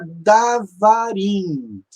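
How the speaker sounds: "davarim" slowly drawn out as da-va-rim; all long syllables. Shown as a demonstration. Teacher shows a form Hebrew rejects, "davarim" with long syllables. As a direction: stretch every vowel of "davarim", syllable by syllable. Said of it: "tantas sílabas longas".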